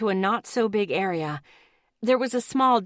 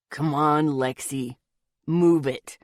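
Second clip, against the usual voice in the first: low bossy man's voice, pushed out; gruff order; 5 semitones lower, slower than usual. A narrator's reading. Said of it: "he ordered".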